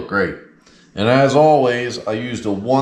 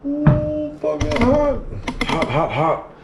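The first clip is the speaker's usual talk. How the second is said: pained yelp; slower than usual. Real, not acted. Burned by hot oil and pan.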